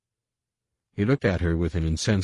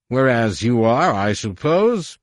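high, slow sneering question; dripping derision; 6 semitones higher, 7 dB louder, slower than usual. A narrator's reading. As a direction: drip scorn on every word. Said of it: "his voice dripping with derision".

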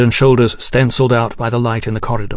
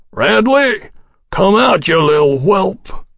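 put-on drunken shout; 8 semitones higher, slower than usual. Drunk general calls out Radley.

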